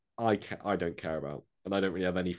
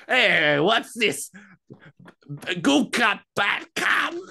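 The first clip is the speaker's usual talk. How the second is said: high, slow squawky villain voice; Penguin impression; 10 semitones higher, 6 dB louder, much slower than usual. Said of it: "Give me your impression of the Penguin".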